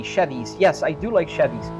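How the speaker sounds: usual speaking voice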